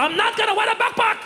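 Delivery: high, laughing refusal; panicked refusal. Retold or imitated. Muhammad refusing the backpack: high laughing panic.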